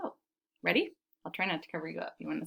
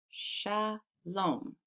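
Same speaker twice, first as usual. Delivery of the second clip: "Shalom" split as sha, pause, lom; softer, two slow beats on "Shalom"; syllable by syllable; 7 dB quieter than usual. Shown as a demonstration. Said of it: "Can you clap shalom".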